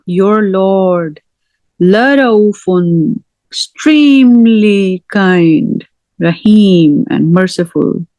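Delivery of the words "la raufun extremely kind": la raufun, extremely kind drawn out slowly, la leaned on; slow emphatic stress. Shown as a demonstration. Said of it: "another emphasis of how kind".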